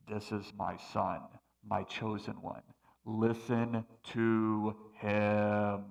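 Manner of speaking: deep, slow, booming voice of God. Acted. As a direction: speak low and slow like God from the clouds. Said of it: "What's he saying?".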